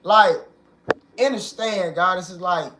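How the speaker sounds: ordinary delivery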